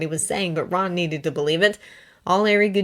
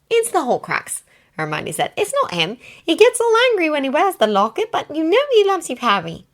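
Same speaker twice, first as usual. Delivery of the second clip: Hermione voice; 10 semitones higher, 6 dB louder than usual. Acted.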